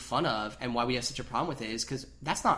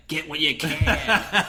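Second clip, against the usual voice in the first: slow, laughing mock gravitas; slow laughing mock-heroic line; mock solemn hero; 4 dB louder, much slower than usual. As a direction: drag it out, half-laughing, like a noble sacrifice.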